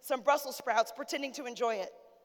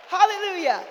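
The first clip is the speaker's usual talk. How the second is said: higher and much louder, ringing out praise; loud hallelujah; 5 semitones higher, 10 dB louder than usual. Real, not acted. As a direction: throw it up to the rafters. Preacher calls out praise.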